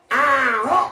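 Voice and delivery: high shouted puppet voice with a laugh. Acted. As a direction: yell the name back stubbornly. Puppet insists on its name.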